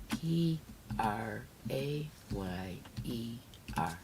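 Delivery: P R A Y E R spelled letter by letter, slowly. Real, not acted. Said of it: "Let's make the keyword prayer".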